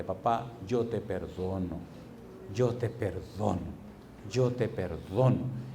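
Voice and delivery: slow, low, softened words of forgiveness; tender forgiveness. Retold or imitated. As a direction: act out a daughter forgiving, drawing each word out. Daughter's forgiveness, solemnly acted.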